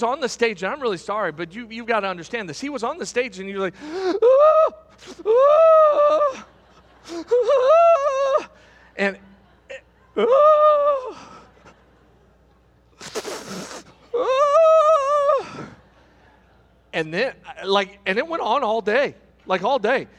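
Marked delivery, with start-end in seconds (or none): 3.8-8.5 loud, very high-pitched mock wail; hammed-up squealing cry; squealing sobs. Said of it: "when he started crying, friends, it wasn't just like a, no, he was a squealer". 10.2-15.7 high, loud mock squeal; hammed-up wail; squealing sobs. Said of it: "he was a squealer".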